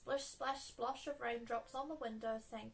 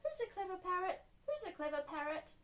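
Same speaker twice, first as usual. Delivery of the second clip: Polly Parrot; 7 semitones higher than usual. A narrator's reading. Polly Parrot recites to Miss Rabbit, high parrot voice. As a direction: repeat it like a chattering parrot. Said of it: "Polly Parrot opened her beak and said".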